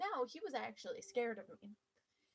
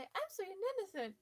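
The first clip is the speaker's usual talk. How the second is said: sweet and innocent; 8 semitones higher than usual. Retold or imitated. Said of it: "I will now always say".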